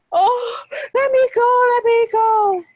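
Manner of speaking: high, loud panicked pleading; screaming plea. Acted. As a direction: scream to be let go. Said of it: "George started screaming".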